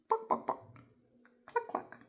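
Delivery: high bawks then breathy clucks; chicken sounds. Shown as a demonstration. Teacher voicing the hen sitting on her nest.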